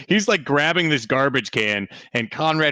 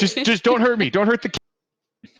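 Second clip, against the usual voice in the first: pleading; 9 semitones higher than usual. Acted. Worker pleading.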